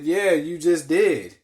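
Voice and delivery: loud, slow, high-pitched comeback; triumphant gotcha. Retold or imitated. Retelling a reply to the supposed Russian spy, triumphant gotcha. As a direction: drag it out loudly, catching them out.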